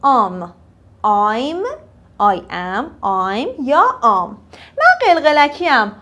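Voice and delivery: ordinary talk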